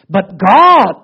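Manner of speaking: loud, declaring with a catch of tears; emphatic proclamation. Acted. David declaring faith to God, loud tearful proclamation.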